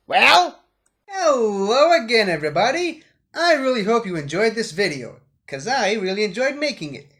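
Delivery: loud, bright sign-off to viewers; YouTube outro voice. Acted. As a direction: hype up the like and subscribe. Channel outro.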